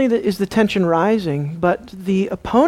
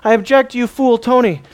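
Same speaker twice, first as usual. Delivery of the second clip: raised, indignant protest; mock objection; 3 semitones higher, 4 dB louder than usual. Congregation protesting to the pastor, mock indignation. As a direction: object out loud as a heckling congregant. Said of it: "everybody should object to".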